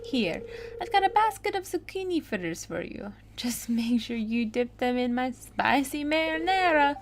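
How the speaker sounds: singsong Italian chef voice; Italian accent. Acted. Chef urging fritters on a hungry man, Italian accent. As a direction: offer it like a hearty Italian chef. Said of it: "I make chefs with an Italian accent".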